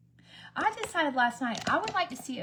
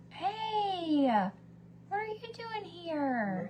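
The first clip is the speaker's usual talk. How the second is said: much higher sing-song hey, slow; surprised greeting; 9 semitones higher, much slower than usual. Real, not acted. Greeting her husband as he walks in, high and playful. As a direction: playfully catch someone walking in.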